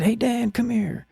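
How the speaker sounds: high, drawn-out call; beckoning call, high and stretched. Retold or imitated. Retells calling a man over.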